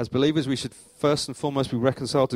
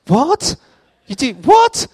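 high, loud, rising shock; acted-out yelp of alarmed disbelief; 13 semitones higher, 12 dB louder, much slower than usual. Teacher reacting to the caretaker's warning, alarmed disbelief.

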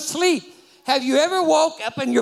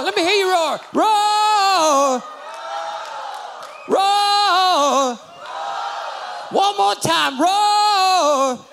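full-force preaching; 5 semitones higher than usual. Acted. Preacher rousing the congregation to stand and praise, shouting. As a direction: stir the church up to its feet.

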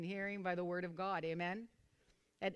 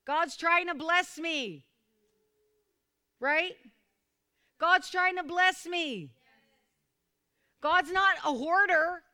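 raised, ringing preacher's voice; fervent preaching; 8 semitones higher, 8 dB louder than usual. Acted. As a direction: preach it up high and loud to the congregation. Preacher builds to fervor.

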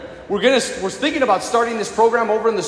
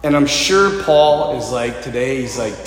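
usual speaking voice

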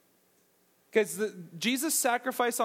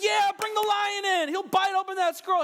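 excited; 11 semitones higher, 4 dB louder than usual. Retold or imitated. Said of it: "I'd be like".

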